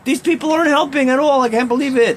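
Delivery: high-pitched imitation of a distressed complainer; retold crying. People crying outside about getting no help, imitated tearful complaint. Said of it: "I've seen many people crying".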